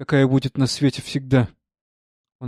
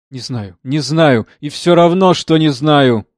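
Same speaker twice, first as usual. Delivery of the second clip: troubled thought building louder; insistent inner outburst; 2 semitones higher, 9 dB louder, slower than usual. A narrator's reading. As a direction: start drawn-out, then rise into a loud insistence. (нарастающее, громкое смятение)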